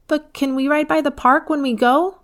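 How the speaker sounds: put-on high pleading child question; a little girl hoping for the park; hopeful child asking. A narrator's reading. Eva asking Dad to pass the park, high and hopeful.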